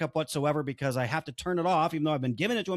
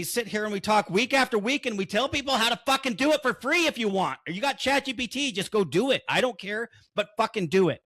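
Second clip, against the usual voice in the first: emotional outburst; 6 semitones higher than usual. Real, not acted. Telling his podcast listeners to protect their business, heated. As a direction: push it out in frustration. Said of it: "You guys can tell I'm a little emotional".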